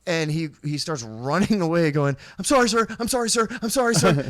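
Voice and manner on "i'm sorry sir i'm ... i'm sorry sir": higher, louder, repeated panicky apology; hurried, high mock pleading; mock frantic apology. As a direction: imitate someone apologizing while scurrying off. Imitating man fleeing with apologies.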